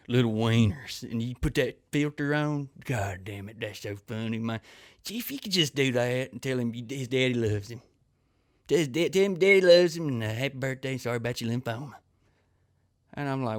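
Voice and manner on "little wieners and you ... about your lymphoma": put-on oblivious parent, tickled by their own request; hammed-up clueless dad asking a favor; cameo requester. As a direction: imitate a gushing fan, swelling louder then settling. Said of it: "the crazy requests I get".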